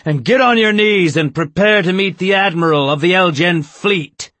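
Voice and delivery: high, loud order; loud commanding yell. Acted. Mole ordering the board to kneel, shouting. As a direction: keep yelling the order.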